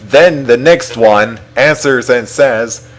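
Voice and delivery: plain reading voice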